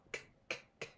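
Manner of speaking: "k" as a bare voiceless click; short unvoweled k, k, k; hard C. Shown as a demonstration. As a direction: pop out three soft "k" sounds. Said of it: "So hard C says what?".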